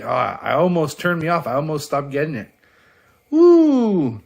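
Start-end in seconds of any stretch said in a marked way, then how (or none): 3.3-4.2 high loud cheer; whoop. Real, not acted. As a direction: cheer like the team just scored. Kill scene finally happens.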